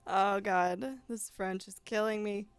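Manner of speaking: laughing. Real, not acted. Laughing at own French.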